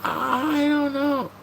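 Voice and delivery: very high, slow put-on voice; silly high voice. Streamer answering chat in a goofy voice.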